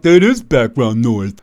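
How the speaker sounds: slow, lightly put-on voice of a dismissive buddy; mocking dismissive friend. Friends brushing off his warnings, slow mock voice. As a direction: drawl it out like a bored skeptic. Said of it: "And they're like".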